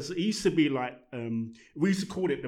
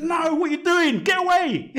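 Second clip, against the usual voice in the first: high, loud, drawn-out mock outrage; mock shouted rejection; 14 semitones higher, 10 dB louder than usual. Girl rejecting his dance moves, mock high shout. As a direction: shout them off in a high imitated voice.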